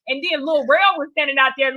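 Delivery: normal talking voice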